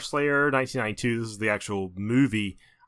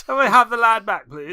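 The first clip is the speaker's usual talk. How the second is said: higher, louder genteel request; posh English gent; 9 semitones higher, 7 dB louder than usual. Pretend. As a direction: ask for the lad back like a polite Englishman. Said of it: "some really sophisticated guy".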